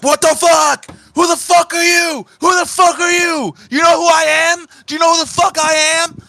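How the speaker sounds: high, loud terrified yelling; panicked screaming. Acted. Terrified man shouting at the assassin who shot his friend, high. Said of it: "is now screaming".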